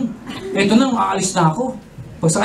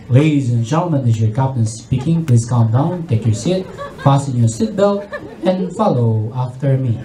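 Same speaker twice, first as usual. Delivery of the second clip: low, calm pilot announcement; calm captain; 10 semitones lower than usual. Acted. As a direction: speak low and composed like a captain. Captain voice again.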